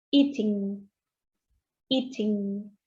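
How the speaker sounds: "eating" said slowly and softly as a model; careful model word. Shown as a demonstration. Teacher models eating for students. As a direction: pronounce "eating" clearly for repetition. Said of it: "Luego tengo la palabra comiendo".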